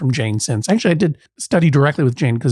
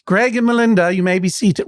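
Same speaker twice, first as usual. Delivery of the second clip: put-on jokey Maine accent, pitched up; 5 semitones higher than usual. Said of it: "the voice insists on itself".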